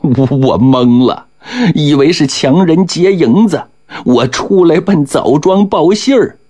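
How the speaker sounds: high-pitched flustered stutter; stammering. A narrator's reading. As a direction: stammer out an excuse while grovelling. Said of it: "结结巴巴地说了".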